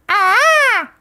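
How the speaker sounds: piercing high peacock shriek; peacock impression. Shown as a demonstration. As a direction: screech like a distant peacock. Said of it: "that's my peacock impression".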